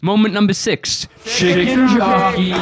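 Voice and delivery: big, slow, drawn-out shout of the line; movie line shout. Retold or imitated. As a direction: belt it like the movie moment. Announcing the famous movie line.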